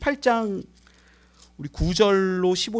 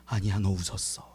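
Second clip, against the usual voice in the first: quiet firm reply; 13 semitones lower, 10 dB quieter than usual. Acted. God's quiet final reply.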